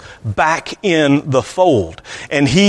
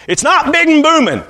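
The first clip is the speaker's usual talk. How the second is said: mock booming; 11 semitones higher than usual. Shown as a demonstration. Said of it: "not in a booming voice".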